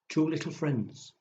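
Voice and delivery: ordinary delivery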